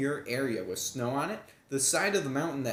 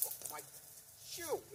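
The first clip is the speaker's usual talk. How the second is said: surprised; 14 dB quieter than usual. Real, not acted. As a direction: caught off guard, squeak it. Mishap with shoe in creek.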